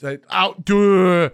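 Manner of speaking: ordinary talk, nothing marked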